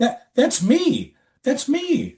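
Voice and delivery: high, slow amazed realization; stunned recognition. Retold or imitated. Narrator recognizes himself in the photos, high and slow. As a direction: say it as if you can't believe it. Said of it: "I go".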